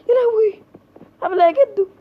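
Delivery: high, loud panicked cry; loud shriek. Real, not acted. Gamer shrieking at grandpa who is chasing her. Gamer frightened yet teasing.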